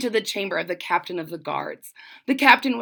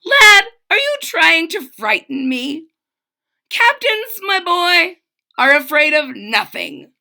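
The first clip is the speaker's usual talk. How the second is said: pompous captain; 7 semitones higher, 7 dB louder than usual. Acted. Boastful captain.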